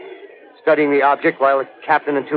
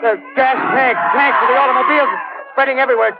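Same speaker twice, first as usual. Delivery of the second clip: terrified shout; 10 semitones higher than usual. Acted. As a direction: scream it out as the fire comes your way. Reporter watching men burn alive.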